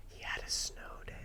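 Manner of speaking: gasp, then whisper it; very soft, breath-only marveling; hushed mock awe. Host marveling at co-host's snow day, hushed awe.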